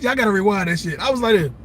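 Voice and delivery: normal talking voice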